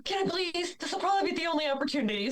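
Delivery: high-pitched, hurried begging; eager retold plea.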